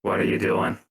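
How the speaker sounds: loud in-character challenge; Safeguard. Acted. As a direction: call out as the guard catching someone. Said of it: "Safeguard would look over and be like".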